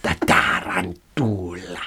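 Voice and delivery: "The tarantula" in an Italian accent, rolled r and open vowels. Shown as a demonstration. Repeating the word for a friend, in an Italian accent. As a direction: say "The tarantula" the Italian way, slowing down on the second. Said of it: "your way sounds like the Brazilian way".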